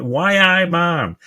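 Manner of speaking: a slow, high mock Geordie "Why, I man"; bad Geordie accent. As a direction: do a waiter's terrible attempt at Geordie. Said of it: "the worst Geordie accent ever".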